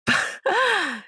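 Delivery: high, mocking giggle; mocking cackle. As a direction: cackle at her expense. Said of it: "깔깔 웃으면서". (높게 깔깔 비웃는 웃음)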